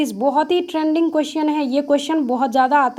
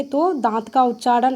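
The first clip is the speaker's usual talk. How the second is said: ordinary delivery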